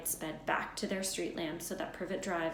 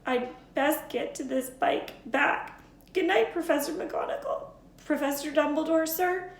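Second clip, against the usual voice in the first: teary muffled voice; 9 semitones higher, 7 dB louder than usual. Acted. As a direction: hold back tears, muffled. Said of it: "said Hagrid in a muffled voice".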